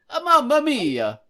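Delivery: put-on Italian accent. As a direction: throw up your hands in mock Italian. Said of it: "my Mario version".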